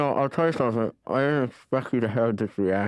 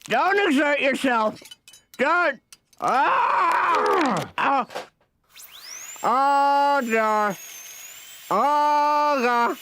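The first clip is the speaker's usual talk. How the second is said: screaming in pain; much slower than usual. Acted. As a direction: yell out in agony. Painful dental procedure.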